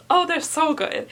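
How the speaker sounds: ordinary talk, nothing marked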